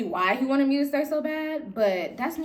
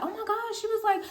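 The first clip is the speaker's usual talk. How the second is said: high fake surprise; 11 semitones higher than usual. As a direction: play innocent surprise, pitched up. Coworker Happy playing dumb.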